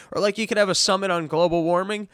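ordinary delivery

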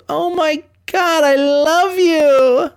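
high, slow swoon like she's overcome; swooning girl impression. Imitating a smitten girl gushing at him, high and slow. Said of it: "and she goes".

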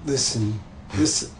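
Alfred Pennyworth impression. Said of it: "That was my Martin Kessler, uh, Alfred Pennyworth impression".

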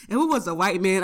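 ordinary talk, nothing marked

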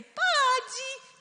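put-on very high, loud toddler yes; delighted consent. Little sister won over by sister's sweetness, delighted. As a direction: burst out a high, gleeful agreement.

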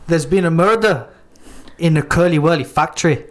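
attempted Scottish accent, not quite there; bad Scottish accent. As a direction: try Scottish again and miss. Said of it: "It weren't Scottish".

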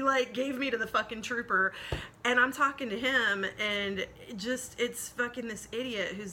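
normal talking voice

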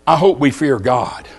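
usual speaking voice

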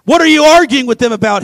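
loud stern question, arguing stressed; stern demand. Acted. Jesus confronting the arguing crowd, loud and stern.

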